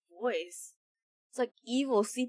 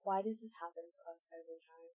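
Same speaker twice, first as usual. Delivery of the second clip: much softer, hushed, quick grumble; hushed exasperated whisper; whispered complaint; 8 semitones lower, 19 dB quieter than usual. Real, not acted. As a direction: mutter it under the breath. Muttered aside after a scare.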